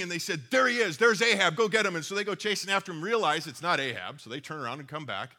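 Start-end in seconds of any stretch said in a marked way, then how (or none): 0.5-2.0 shouting. Retold or imitated. Enemy soldiers spot the king.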